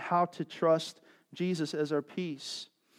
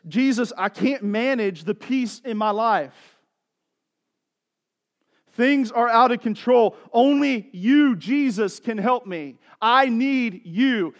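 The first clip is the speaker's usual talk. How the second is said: slow, louder pleading to Jesus; pleading prayer; 7 semitones higher, 9 dB louder, slower than usual. Pretend. A believer confessing he needs Jesus, slow pleading prayer. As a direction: pray it slowly, begging for help. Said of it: "statements that look like this".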